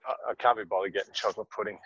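ordinary talk, nothing marked

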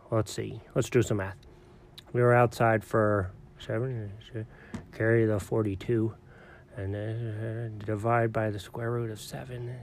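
very soft, low mumbling to oneself; muttered calculating. Acted. Working out when he will crash, muttered calculating. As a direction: mutter through the sum haltingly.

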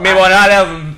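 loud, slow mock accent; mock his order slowly and loudly. Mocking friend's order.

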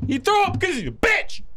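high-pitched mock-drunk shout; heckling yell. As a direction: yell it over your friend. Heckling yell over friend's story.

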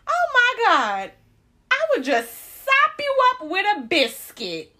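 very high, slow, loud cooing; imitating a stranger fawning over a baby; gushing lady passerby. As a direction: gush it sky-high and stretched out. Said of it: "She said".

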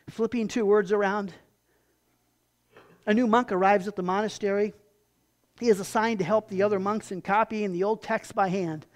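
ordinary delivery

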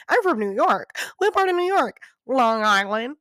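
high, put-on voices trading question and answer; mocking passengers' chatter. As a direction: mimic a boastful back-and-forth between strangers. Acts out passengers claiming New York.

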